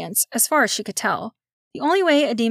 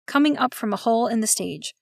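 plain reading voice

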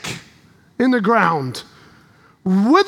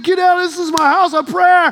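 Jesus yelling at the money changers, raised voice; mock shout; 4 semitones higher, 3 dB louder than usual. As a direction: drive them out, voice raised. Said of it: "yelling, screaming".

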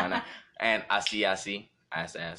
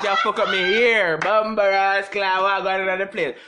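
loud, high Jamaican patois rant; Jamaican accent; 8 semitones higher, 6 dB louder than usual. Pretend. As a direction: scold in thick Jamaican patois, louder. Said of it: "We weren't real Jamaican".

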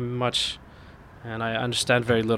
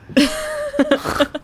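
high pealing laughter. Real, not acted. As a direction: crack up at your own punchline. Laughing at story's punchline.

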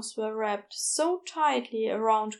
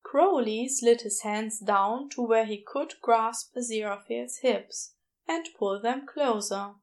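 ordinary delivery, nothing marked

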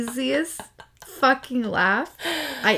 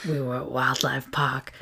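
whisper; 4 semitones lower, 5 dB quieter than usual. Acted. Gina soothing injured Kyle.

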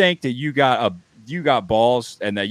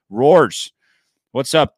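louder, higher hail by name; calling out; 5 semitones higher, 7 dB louder, slower than usual. Real, not acted. Host bringing his co-host into the show, calling out. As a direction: call the co-host in with punch.

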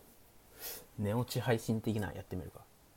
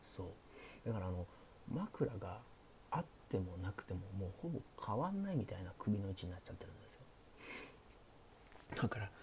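hushed late-night chat, often whispered; sleepy; 4 dB quieter than usual. Pretend. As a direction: talk softly like the listener is drifting off. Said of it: "寝落ち配信なんでね". (小声でささやく寝落ち配信トーク)

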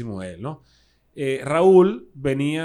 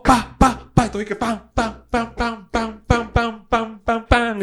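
high, rhythmic vocal percussion; imitated band hits; 7 semitones higher, 5 dB louder, slower than usual. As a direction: pound out the beat with your voice. (golpes rítmicos imitados, en tono agudo)

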